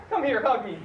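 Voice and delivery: ordinary talk, nothing marked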